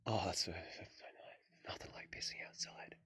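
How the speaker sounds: relieved breathy whisper. Real, not acted. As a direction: hush it out, relieved. Peeing outside, relieved whisper.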